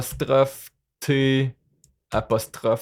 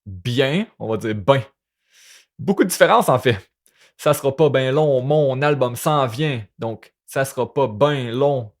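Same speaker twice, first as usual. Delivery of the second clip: usual speaking voice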